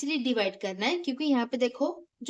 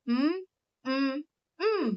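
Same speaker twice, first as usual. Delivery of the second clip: three separate hums, one per syllable; syllable-counting hums; closed-mouth hum; 2 semitones higher than usual. Shown as a demonstration. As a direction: hum banana with lips closed. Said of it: "मुंह बंद करूंगी".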